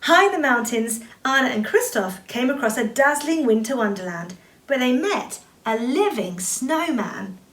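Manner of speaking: plain reading voice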